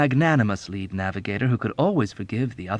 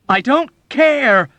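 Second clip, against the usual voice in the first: high, loud, slow desperate cry; desperate shout; 10 dB louder, slower than usual. Acted. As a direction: yell it in despair. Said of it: "Nately shouted desperately".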